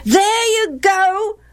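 put-on high, drawn-out childish taunt; boys chorusing. A narrator's reading. Other boys echoing their mother's reproach, chorusing. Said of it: "the other boys chorused".

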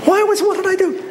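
very high, startled outburst; sudden high protest; indignant surprise. Acted. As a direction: yelp it out, caught off guard. Hit again by fruit.

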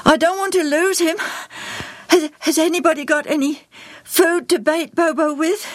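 put-on high, breathless boy's voice; panting. A narrator's reading. Joel fears losing his parrot, panting. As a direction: gasp it out, pitched high. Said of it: "Joel panted".

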